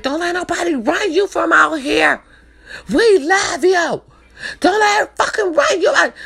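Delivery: fierce, tearful plea not to let her run you out; cousin going off. Retold or imitated. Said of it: "My little cousin was going off".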